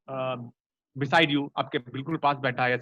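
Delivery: usual speaking voice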